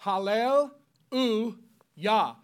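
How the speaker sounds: "Hallel, U, Yah" said as separate parts; broken into parts. Shown as a demonstration. Preacher breaking hallelujah into its Hebrew parts. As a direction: split the word into its three parts. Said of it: "three words in Hebrew".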